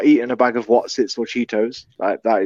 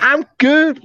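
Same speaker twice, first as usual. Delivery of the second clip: squeaky voice; 12 semitones higher, 3 dB louder than usual. Pretend. Continuing the high joke voice.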